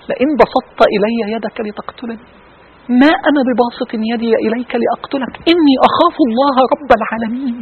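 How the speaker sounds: quiet recitation. Shown as a demonstration. Preacher softly reciting the verse to his audience. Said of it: "reciting Quran verse slowly".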